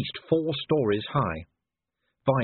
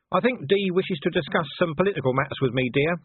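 smooth, raised-pitch husband's hint; 7 semitones higher than usual. A narrator's reading. Husband easing his wife away for political talk, smoothly. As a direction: glide politely into dismissing her. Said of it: "said Vimes smoothly".

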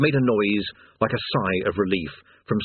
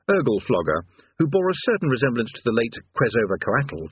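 plain reading voice

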